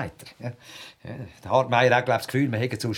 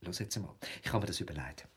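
much softer, drawing him close; hushed confiding; 5 semitones lower, 14 dB quieter, faster than usual. Real, not acted. Colleague shares his motto plan: hushed. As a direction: lean in and let him in on it.

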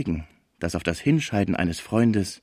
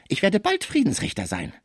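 much higher-pitched menacing voice; threatening Frisier; 11 semitones higher than usual. A narrator's reading. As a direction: threaten in a high, thin register. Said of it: "Man trennte sich unter Drohungen". (drohend, mit hoher Stimme)

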